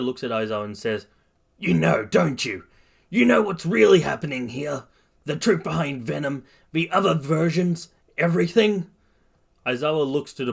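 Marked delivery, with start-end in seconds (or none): 1.6-8.9 higher, raised, pressing questions; insistent confrontation. Acted. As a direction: press the teacher for the truth. Bakugo confronts his teacher.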